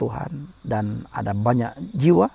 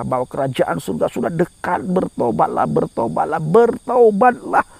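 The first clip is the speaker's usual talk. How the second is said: building exhortation; 4 semitones higher, 3 dB louder than usual. Acted. Preacher calls sinners to repent, building to a high cry. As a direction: build each BERTOBATLAH, stretching the last.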